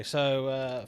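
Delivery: ordinary talk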